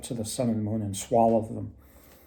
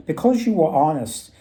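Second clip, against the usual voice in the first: louder, higher elf granting a reward; 4 semitones higher, 6 dB louder than usual. Retold or imitated. Elf rewards the honest woodsman, raised voice. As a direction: announce the reward like a kindly elf.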